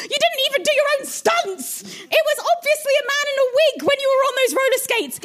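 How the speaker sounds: very high, sneering diva voice; mocking Miss Piggy. Piggy trades insults.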